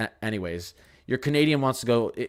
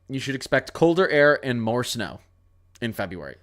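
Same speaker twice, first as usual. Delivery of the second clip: usual speaking voice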